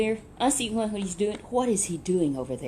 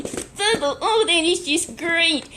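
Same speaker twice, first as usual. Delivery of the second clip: high, loud and slow amazement; a master amazed at the profit; delighted master; 9 semitones higher, 5 dB louder, slower than usual. Acted. Master delighted at doubled money.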